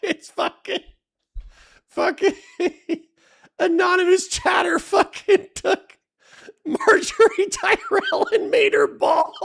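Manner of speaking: very high squeal through uncontrollable laughter; hysterical laughing. Real, not acted. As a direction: force the words out while helpless with laughter. Explains image while cracking up.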